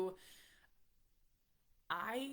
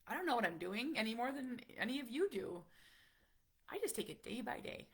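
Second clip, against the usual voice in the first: ordinary delivery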